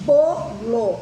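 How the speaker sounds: BOLO in two careful syllables, ending in a full O; "bolo" sounded out as spelt, O not U; careful final O. Shown as a demonstration. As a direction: say "bolo" loudly, with a clear O at the end. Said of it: "Eu não falo bolo".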